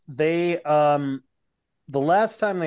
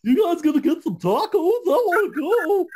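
much higher and louder, silly whiny voice; goofy voice; 11 semitones higher, 4 dB louder than usual. Pretend. Orange Cassidy asking friends for tacos, goofy high whine. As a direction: beg for tacos like an overexcited kid. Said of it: "has a really goofy voice".

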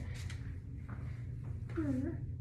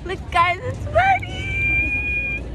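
very high, slow, stretched-out squeaky voice; silly Buddy voice; 7 dB louder, much slower than usual. Pretend. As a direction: speak for Buddy, drawn-out and squeaky.

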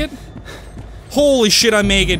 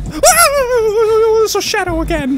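high, loud yelp of alarm; panicked wail; 11 semitones higher, 4 dB louder, slower than usual. Real, not acted. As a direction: wail while dodging danger. Fleeing a shadow in game.

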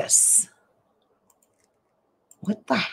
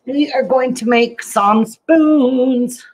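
higher, louder and slow, presenting; upbeat announcement; 8 semitones higher, 5 dB louder, slower than usual. Real, not acted. Vlogger presenting the day's craft to viewers, upbeat. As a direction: announce it like a show opener.

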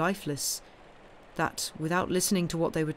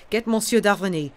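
urgent; 3 semitones higher, 7 dB louder than usual. A narrator's reading. Panicked father calling.

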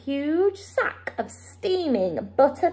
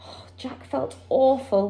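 ordinary delivery

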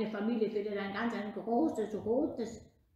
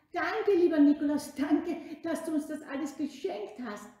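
grateful excitement; 7 semitones higher, 10 dB louder than usual. Acted. Leute danken Nikolaus begeistert.

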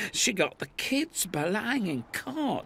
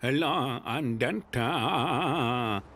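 slow dopey voice; 4 semitones lower, much slower than usual. Acted. Character introduces himself slowly.

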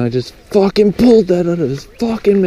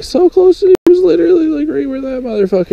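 higher, faster, keyed-up telling; excited rush; 13 semitones higher, faster than usual. Real, not acted. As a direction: rattle it off still buzzing. Describing near-miss fish excitedly.

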